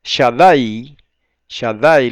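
Shaddai punched loudly on the A; stress on A. Shown as a demonstration. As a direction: say Shaddai as sha-DAI, loud and deliberate. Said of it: "lleva el acento en la A".

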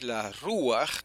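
"Ruach" stressed on the U, RU-ach. Shown as a demonstration. Teacher correcting the accent, "Ruach" with a stressed U. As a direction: lean on the "Ru" of "Ruach". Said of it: "con acento en la U".

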